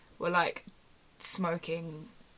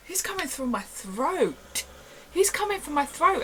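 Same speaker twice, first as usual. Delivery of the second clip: alarmed; 9 semitones higher than usual. Retold or imitated. Retelling panicked alarm.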